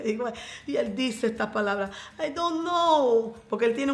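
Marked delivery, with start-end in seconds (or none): 2.2-3.3 high, slowly drawn-out Transylvanian English; Transylvanian accent. Retold or imitated. Wife quoting her partner's bewildered reply, imitated Transylvanian accent. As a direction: mimic his Dracula-like accent, stretching each word high. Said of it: "un acento así, como medio Drácula".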